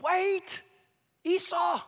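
high, loud call to stop him; pretend scream. Preacher imagines calling out to Esau, loud and high. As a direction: shout a warning across time. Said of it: "I could just scream".

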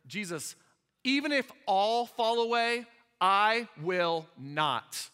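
bold declaration. Acted. Peter boldly vows loyalty to Jesus, loud and emphatic. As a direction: declare it boldly, slowing hard on the refusal. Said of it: "He's so bold".

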